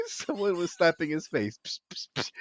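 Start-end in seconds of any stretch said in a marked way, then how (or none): 1.7-2.3 whisper. Acted. Trying to rouse Harry.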